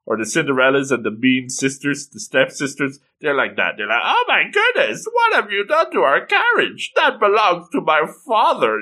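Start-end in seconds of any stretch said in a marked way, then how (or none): 4.0-8.8 high-pitched, haughty mock outrage; high and mighty. High and mighty city woman protesting over her carriage, mock indignant. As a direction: imitate an indignant stuck-up stepsister. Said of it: "the high and mighty women from the big city".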